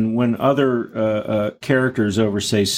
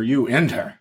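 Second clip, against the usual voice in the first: "enter" said with grand weight, like making an entrance; stress on "enter". Shown as a demonstration. Interviewer showing how a grand entrance is said, stressed. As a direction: make "enter" an event. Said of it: "you don't just enter".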